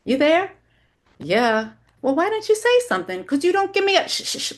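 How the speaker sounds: fast high motherly prattle; chattering mother. Acted. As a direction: nag her daughter in a shrill rush. Mother voiced on phone, high.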